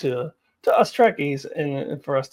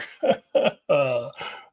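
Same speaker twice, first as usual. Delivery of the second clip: amused laugh; 2 semitones lower than usual. Real, not acted. Laughs at puppet episode news.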